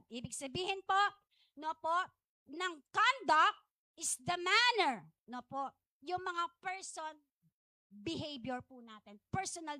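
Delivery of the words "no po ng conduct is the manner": ordinary delivery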